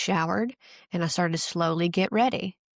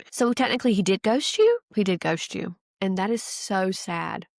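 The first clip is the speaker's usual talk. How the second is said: usual speaking voice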